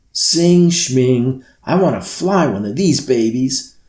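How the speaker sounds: put-on loud, high, pushy kid voice; eager girl. Lily to the Sandman, loud and impatient. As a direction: brush off the singing, itching to fly.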